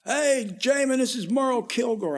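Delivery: mild imitation of a hearty caller. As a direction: hail him brightly like a manager on the phone. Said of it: "I get a call from [name]".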